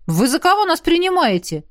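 high, indignant question. A narrator's reading. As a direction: protest with offended pride. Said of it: "возмутился Дин".

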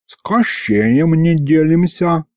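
high, drawn-out accusation; slow, high demon prompting; demon echoing the interrogator. A narrator's reading. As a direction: chime in, mimicking an investigator. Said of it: "в тон мне подсказал бес".

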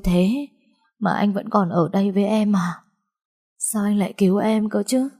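weakly forcing words out. A narrator's reading. Ashamed patient to the man who saved her, weak and anguished. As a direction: strain out a distraught plea. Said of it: "Tôi yếu ớt gằn giọng".

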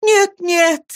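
high, tearful cry of no; a young girl's high tearful protest; sobbing cry. A narrator's reading. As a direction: cry it out through sobs. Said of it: "крикнула Эмилия, качая головой и рыдая".

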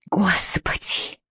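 soft whispered groan to God; a husband muttering in despair. A narrator's reading. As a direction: breathe it out, exhausted. Despairing sigh to himself.

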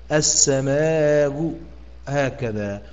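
السماء drawn slowly with the final hamza softened by rawm; recited demo. Shown as a demonstration. Teacher demonstrating rawm on السماء to students, slow.

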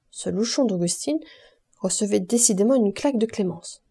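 ordinary delivery, nothing marked

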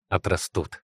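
low, soft reassurance; reassuring promise. A narrator's reading. Father comforting daughter about lost hair, soft promise. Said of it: "пообещал он".

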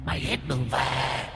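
soft whispered ghostly threat. A narrator's reading. Ghost woman whispers that he cannot escape. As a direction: whisper his doom like a ghost.

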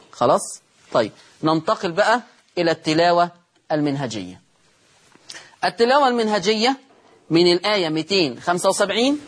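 ordinary talk

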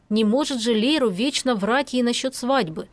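ordinary delivery, nothing marked

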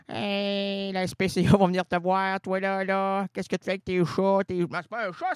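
normal talking voice

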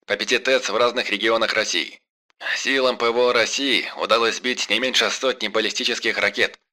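loud formal broadcast read; radio announcement. Acted. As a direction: official emergency bulletin voice. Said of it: "голос из радиоприёмника".

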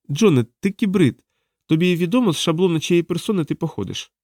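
high-pitched, insistent explainer; interrogating woman. Acted. Diana explains cybrids, then calls to John, insistent.

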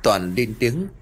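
usual speaking voice

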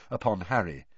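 plain reading voice

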